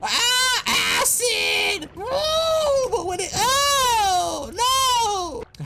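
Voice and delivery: very high, loud drawn-out wail; screaming. Pretend. Streamer hit by acid in game, screaming. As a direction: shriek in over-the-top agony.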